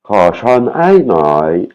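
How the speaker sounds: ko chon hai noi said slowly; repeat after. Shown as a demonstration. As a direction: echo the machine voice slowly. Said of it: "écouter, répéter".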